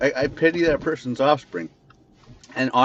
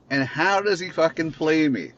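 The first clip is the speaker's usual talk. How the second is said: higher, outraged disbelief; exasperated anger rising up; indignant outburst; 7 semitones higher, 4 dB louder than usual. Real, not acted. Outraged at George's betrayal.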